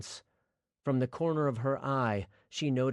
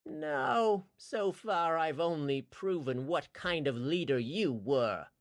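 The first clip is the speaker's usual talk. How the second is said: higher, mocking villain voice; sneering villain; 6 semitones higher than usual. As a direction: gloat over the dying man. Towin taunts the dying man.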